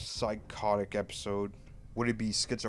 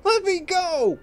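put-on high loud screaming child; crying out; 13 dB louder than usual. Son dragged to the bathroom cries out, high scream. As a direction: wail it like a desperate boy. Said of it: "he cried out".

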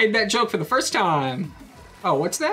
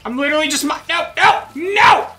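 high yelps of "no", getting louder; panicked protest; 6 semitones higher, 4 dB louder than usual. Real, not acted. Player fleeing an attacker, panicked protesting. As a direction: fend them off with urgent yells.

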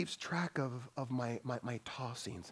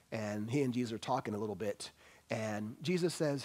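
ordinary talk

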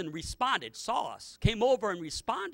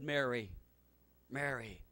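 tender call; 7 semitones lower, 7 dB quieter than usual. Acted. Jesus softly calls Mary.

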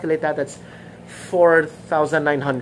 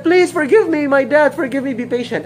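pleading debtor; 7 semitones higher than usual. Acted. Debtor servant begging for mercy, high then fading. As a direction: beg desperately for mercy. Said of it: "And then the guy said".

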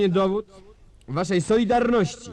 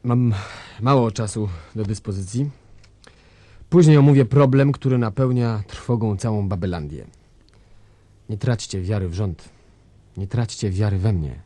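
pompous dictator; 9 semitones lower than usual. Acted. Dictator reassuring the nation of his rule, deep and grand. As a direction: boom it out like a tin-pot ruler.

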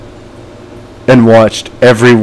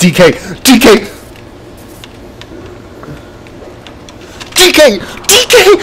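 calls climbing much higher each time; rising shouts; 11 semitones higher than usual. Real, not acted. Gamer yelling at Donkey Kong as he wins, excited shout. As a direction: yell the name, higher every call.

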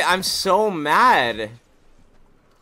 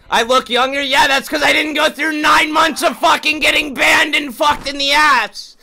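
heated rant; 12 semitones higher, 8 dB louder than usual. Real, not acted. Ranting about getting banned.

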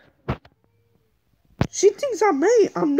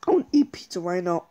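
1 rushed craving; 13 semitones lower, 4 dB quieter, much faster than usual. Real, not acted. Craving pizza.

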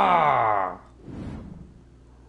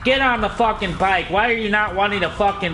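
pissed off; 5 semitones higher than usual. Real, not acted. Streamer losing it at his game, angry yelling. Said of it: "I'm so pissed off right now".

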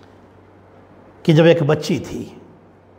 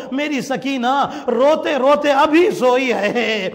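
impassioned mourning narration, building in pitch; lamenting sermon; 5 semitones higher than usual. Acted. Preacher telling mourners of the search for Sakina, building lament. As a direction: build steadily into a grieving lament.

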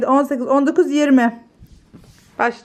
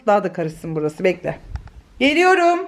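ordinary talk

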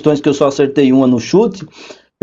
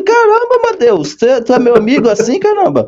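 exasperated; 7 semitones higher than usual. Retold or imitated. Exasperated retort to doubting friend.

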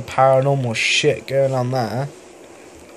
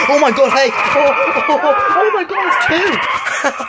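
real panicked scream, much higher and louder; terrified scream; 12 semitones higher, 4 dB louder than usual. Real, not acted. Player caught by two monsters, panicked screaming. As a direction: shriek as the monsters lunge at you. Jump scare in horror game.

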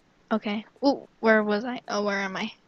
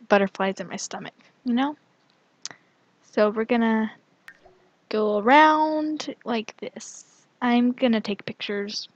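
ordinary talk, nothing marked

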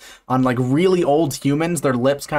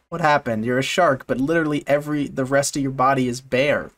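ordinary talk

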